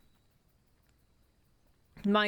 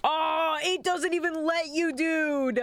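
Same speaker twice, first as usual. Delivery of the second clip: frustrated shout; 8 semitones higher, slower than usual. Real, not acted. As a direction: shout at the game in disbelief. Game blocks move, frustrated shout.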